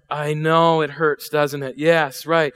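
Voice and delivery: ordinary talk, nothing marked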